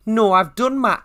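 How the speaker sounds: hammed-up, shaky attempt at a Welsh accent; failed Welsh accent. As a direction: overdo a Welsh accent, not quite landing it. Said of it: "I can't do it".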